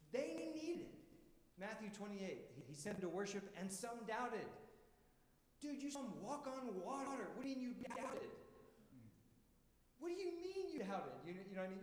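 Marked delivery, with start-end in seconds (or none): 0.1-1.0 high, intense preacher emphasis; sudden high pleading emphasis; emphatic. Real, not acted. Preacher stressing disciples' need. 5.6-8.3 high, disbelieving challenge; high incredulous mock scolding. Incredulous aside to disciples. 10.0-11.2 very high, exasperated repeat; pitched-up exasperated echo; incredulous. Retold or imitated. Repeats the question louder in pitch.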